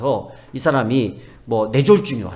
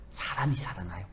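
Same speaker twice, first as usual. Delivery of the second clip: whisper; 6 semitones lower, 12 dB quieter than usual. Real, not acted. Preacher's hushed emphasis.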